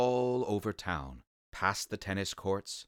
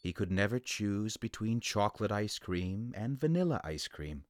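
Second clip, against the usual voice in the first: ordinary delivery, nothing marked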